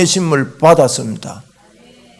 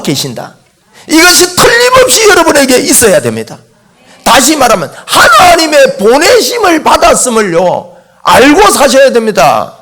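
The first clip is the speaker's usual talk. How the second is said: forceful preaching; 5 semitones higher, 3 dB louder than usual. Real, not acted. Preacher telling people they must know God sent them, forceful preaching. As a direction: drive each phrase home to the congregation.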